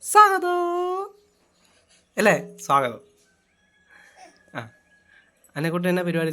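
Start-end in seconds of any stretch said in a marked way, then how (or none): 0.0-1.2 bright high welcome to viewers; vlog greeting. Acted. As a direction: greet the audience like a host. (Vlog സ്വാഗതം)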